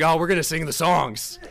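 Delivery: loud, emphatic mock dad; mock insistence. Dad to guest who asked which song, emphatic insistence. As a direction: push it out loudly, brooking no choice.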